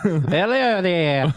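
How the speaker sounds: slow, high talking-toy voice; drawn out like a pull-string figure. Shown as a demonstration. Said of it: "Doing Ewan McGregor from Fargo season three".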